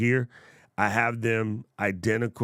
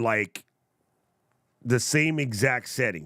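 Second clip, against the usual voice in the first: usual speaking voice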